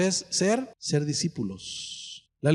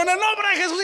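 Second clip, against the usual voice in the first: high, shouting rebuke; over-the-top exorcism cry; mock shouted prayer; 13 semitones higher, 4 dB louder, faster than usual. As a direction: yell it into her ear.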